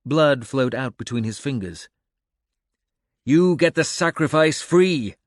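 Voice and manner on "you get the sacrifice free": loud, high barked command; barked order. A narrator's reading. Coe barks at his ally mid-fight, loud and commanding. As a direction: bark the order across the fight. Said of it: "Coe barked".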